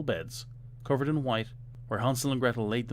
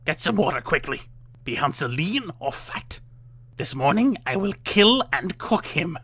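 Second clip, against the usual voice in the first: loud, higher raging witch; loud furious witch orders; witch in a passion; 6 semitones higher, 6 dB louder than usual. A narrator's reading. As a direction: rage at the girl. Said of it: "she called out in a passion".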